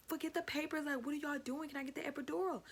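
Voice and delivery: fed up, push them to hurry; exasperated. Retold or imitated. Exasperated at nurse.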